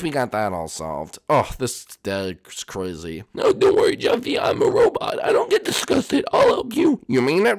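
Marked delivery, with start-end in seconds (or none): 3.3-7.0 much higher, odd robot voice. Acted. Robot number two reassures Jeffy, high robot voice. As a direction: sound like a cheerful little machine. Robot brother offers help.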